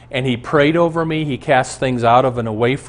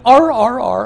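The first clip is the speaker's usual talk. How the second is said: high, loud imitated barks; yapping "arr, arr"; barking animal; 12 semitones higher, 8 dB louder than usual. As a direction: bark like a small angry dog. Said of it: "And the thing went".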